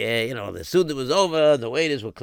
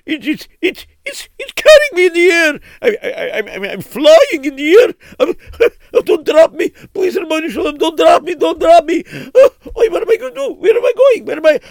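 high, loud panicked cries; screaming while lifted in the air; terrified; 9 dB louder than usual. Acted. Carried off by the wind.

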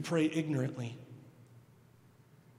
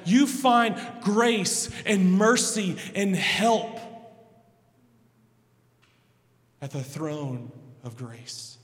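higher, louder impassioned preaching; full-force preaching; 4 semitones higher, 7 dB louder than usual. Acted. Pastor urging the congregation, loud and impassioned. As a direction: preach it out with fire.

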